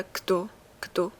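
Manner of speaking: «کدو، کدو» with the d sounding like t; Serbian kdo. Shown as a demonstration. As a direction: repeat «کدو» twice, d close to t.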